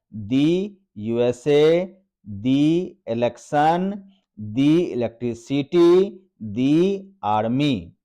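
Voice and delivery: slow, drawn-out दी with a long ee; "दी" long ee before each word; slow "thee" drill. Shown as a demonstration. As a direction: read each example slowly for the class. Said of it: "तो इस तरह से इसे पढ़ा जाता है".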